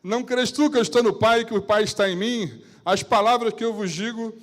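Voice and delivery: bem alto e agudo, proclamando; Jesus em pregação forte. Acted. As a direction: proclame com toda a força. Jesus pregando com força. (Jesus proclamando para Filipe, em voz alta e intensa)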